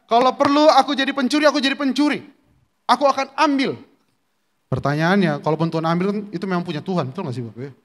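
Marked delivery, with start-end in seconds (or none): 0.1-3.7 Jesus's words raised higher; voice of God. Acted. Preacher voicing Jesus to the congregation, raised higher. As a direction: proclaim it high as the Lord speaking. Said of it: "dia bilang".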